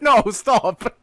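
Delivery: high mock-crying plea; imitated crying brother. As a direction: plead like a distressed kid. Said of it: "my brother's crying, like".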